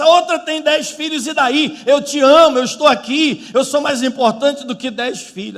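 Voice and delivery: high, loud insistent protest; acted-out husband's heated appeal; exasperated husband. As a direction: insist on your love in frustration. Husband protests his love to wife.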